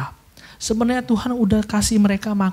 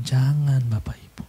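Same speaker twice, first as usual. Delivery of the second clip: hushed warning; 5 semitones lower, 5 dB quieter than usual. Real, not acted. Preacher's warning to congregation.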